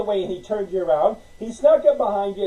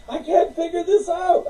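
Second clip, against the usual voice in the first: high mock whine; a hint of helpless whining; whiny complaint; 9 semitones higher than usual. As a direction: mimic someone giving up. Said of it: "people who keep saying that".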